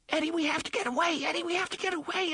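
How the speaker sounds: put-on high, fast, panicked child's voice; frightened child. A narrator's reading. Tiny voice begging Eddie to run, fast and frightened. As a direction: rush it out like a scared little kid. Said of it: "the tiny mourning voice said".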